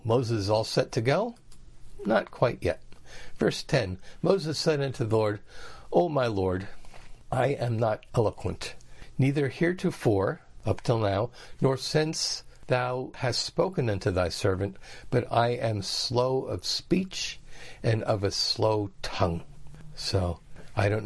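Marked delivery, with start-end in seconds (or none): none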